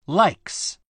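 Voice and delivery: "likes" ending in a voiceless s; final S voiceless. Shown as a demonstration. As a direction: say likes with only breath on the final s. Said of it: "likesはす".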